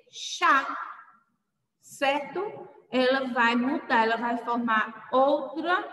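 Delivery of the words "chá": "chá" with a single SH sound; som novo de CH. Shown as a demonstration. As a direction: say "chá" as SHA, not KA. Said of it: "Mas, nesse caso, ela vai ser". ("chá" com som de X)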